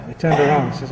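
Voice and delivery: ordinary talk, nothing marked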